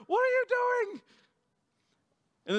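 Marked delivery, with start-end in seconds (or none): 0.1-1.0 high, louder panicked cry; frantic sailors shouting over the storm; panicked sailors yelling. Retold or imitated. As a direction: yell it up high in alarm.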